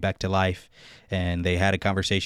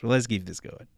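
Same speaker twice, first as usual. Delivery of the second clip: usual speaking voice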